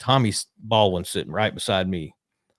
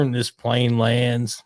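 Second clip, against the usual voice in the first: ordinary talk, nothing marked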